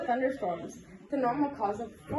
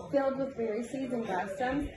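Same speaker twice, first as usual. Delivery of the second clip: ordinary delivery, nothing marked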